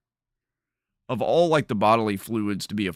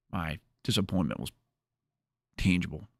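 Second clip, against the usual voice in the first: ordinary delivery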